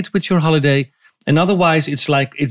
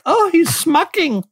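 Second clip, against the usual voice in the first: high, slow disgusted whine; grossed-out listener; 13 semitones higher, slower than usual. Retold or imitated. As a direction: squeal it in disgust. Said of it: "are grossed out right now, like".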